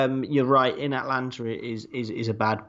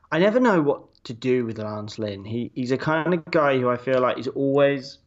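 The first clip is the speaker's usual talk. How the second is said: usual speaking voice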